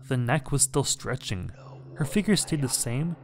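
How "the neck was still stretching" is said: ordinary delivery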